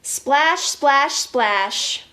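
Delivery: playful splash. Acted. Splashing toy dinosaurs.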